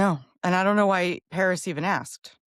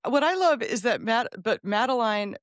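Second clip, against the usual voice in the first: ordinary delivery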